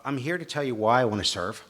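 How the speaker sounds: normal talking voice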